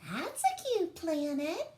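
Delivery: high, squeaky little alien; Bork's voice. Acted. Bork admires a planet in a high alien voice. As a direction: pitch it up like a tiny alien. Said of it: "said Bork".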